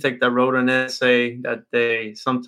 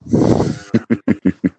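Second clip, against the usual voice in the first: laugh; 4 semitones lower, 7 dB louder than usual. Real, not acted. Host laughs at own joke.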